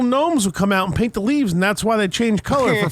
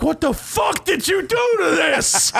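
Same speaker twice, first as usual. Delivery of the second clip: higher, slow hammed-up dad shout; imitating yelling dad; 9 semitones higher, slower than usual. Son imitates dad tasting too-spicy ziti, hammed-up shout. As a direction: belt out the dad's disgust.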